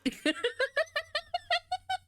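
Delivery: shrieking giggle; high squealing laugh. Real, not acted. Laughing at chat.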